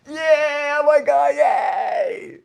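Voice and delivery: very high, loud whoop of joy; hammed-up jubilant shriek; euphoric lottery win. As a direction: shout it like you've hit the jackpot. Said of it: "like you've just won the lottery".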